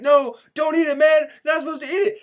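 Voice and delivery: high, loud alarmed pleading; panicked plea. Retold or imitated. To his friend about to eat it, a panicked high plea.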